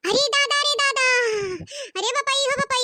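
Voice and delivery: mock-horrified exclamation; shocked wail. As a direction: wail in disbelief at the scheme. Shocked at the scheming woman.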